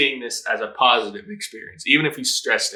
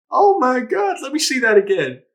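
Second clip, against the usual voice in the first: ordinary talk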